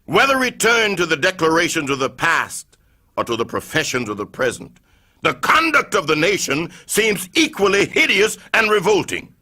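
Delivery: ordinary delivery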